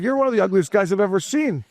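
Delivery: high-pitched mock insult; retold taunt, voice riding up high; heckler comeback. Fan insulting the man who mocked his bald spot, mock indignant.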